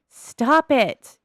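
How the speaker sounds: exasperated scolding. Real, not acted. Scolding a misbehaving game character.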